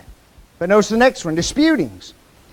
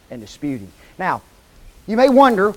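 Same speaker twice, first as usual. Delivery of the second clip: ordinary talk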